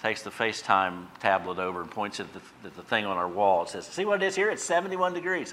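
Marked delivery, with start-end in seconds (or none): 3.9-5.5 lightly voiced wife, higher, teasing; a hint of the wife bragging; mock wife. Wife teasing family in Texas over FaceTime, lightly voiced higher. Wife showing off cool house temperature.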